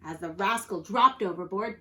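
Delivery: ordinary delivery, nothing marked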